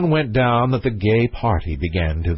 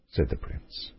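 plain reading voice

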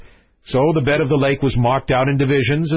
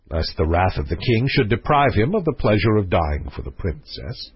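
plain reading voice

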